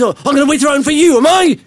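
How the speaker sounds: hammed-up sarcastic snap, fast and high; mock angry retort. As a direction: mimic someone huffy, exaggerating it. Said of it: "that's not how I said it".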